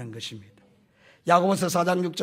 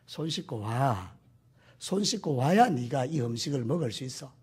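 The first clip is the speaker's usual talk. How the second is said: aviso lento y más suave, con cariño; padre paciente; 5 semitones lower, 4 dB quieter than usual. Acted. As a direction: advierte despacio, con amor. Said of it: "사랑의 부모님이니까".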